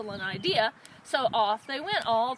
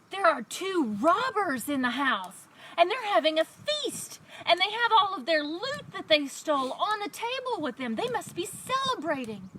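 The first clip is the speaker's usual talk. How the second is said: higher-pitched, eager report; excited donkey; 6 semitones higher than usual. A narrator's reading. Donkey tells his friends about the robbers, excited. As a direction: tell the discovery in a high, excited voice. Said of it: "The donkey told his friends".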